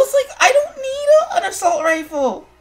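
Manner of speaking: ordinary delivery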